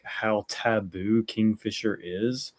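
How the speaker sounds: ordinary delivery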